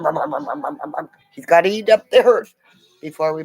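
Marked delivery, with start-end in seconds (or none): 0.0-1.1 lips buzzing brum brum like a motor, fading; tiller engine noise. Retold or imitated. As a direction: mimic a tiller chugging.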